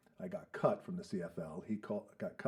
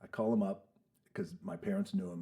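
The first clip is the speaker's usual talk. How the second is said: ordinary talk, nothing marked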